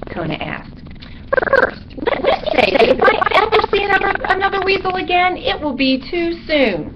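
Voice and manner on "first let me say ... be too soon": high, fast, fussy bat voice; squeaky bat griping; exasperated little bat. A narrator's reading. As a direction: rattle off a complaint up high. Bat character complains.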